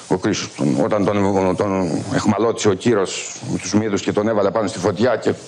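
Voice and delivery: ordinary talk, nothing marked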